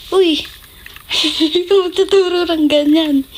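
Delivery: high, loud laughing disbelief; shocked laughing protest. Real, not acted. Daughter reacting loudly to dad's bad advice, shocked laugh. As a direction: yelp in shock, then laugh it off.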